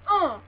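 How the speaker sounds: short loud pained grunt; imitated grunt of pain. Vlogger imitating Cena's victim, loud pained grunt. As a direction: grunt as if hit below the belt. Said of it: "'cause he just went".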